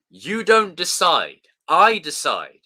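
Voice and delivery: loud, slow dramatic quote; movie line shout. Pretend. Quoting a film to chat, loud and slow. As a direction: bark it like a villain.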